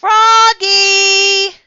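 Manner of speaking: very high, loud, drawn-out call. A narrator's reading. Mom calling Froggy back to his seat, calling out. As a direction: holler the name, long and high. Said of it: "called his mom".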